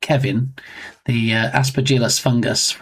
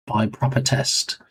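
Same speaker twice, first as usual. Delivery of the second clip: exhausted TTS; 2 semitones lower, 5 dB quieter than usual. Acted. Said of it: "That pause was great".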